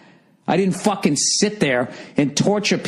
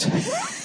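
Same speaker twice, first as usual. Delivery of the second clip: short laugh; 6 dB quieter than usual. Real, not acted. Laughs at own irony.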